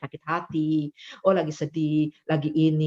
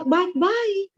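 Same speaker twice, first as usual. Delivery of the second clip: cheery reply; 13 semitones higher than usual. Retold or imitated. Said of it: "dia bilang".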